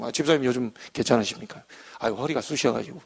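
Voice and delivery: two friends chatting on a walk, soft and easy; easy chat. Retold or imitated. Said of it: "이거 술술 얘기하지".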